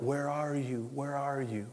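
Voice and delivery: a soft, far-away longing cry; longing call. Acted. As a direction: call out gently, aching for them to answer. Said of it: "And God's crying out".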